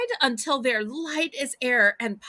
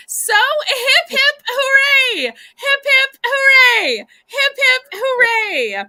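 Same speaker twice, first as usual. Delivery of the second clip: high, loud, drawn-out cheer; hooray cheer; 10 semitones higher, 9 dB louder than usual. Real, not acted. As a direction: cheer it out like a party. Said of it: "Everybody celebrate Lisa".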